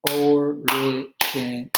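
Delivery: originated said slowly, beat by beat; slow syllable clapping. Shown as a demonstration. Teacher claps out originated slowly for students. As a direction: spell out originated in slow beats. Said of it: "clapping syllables demo".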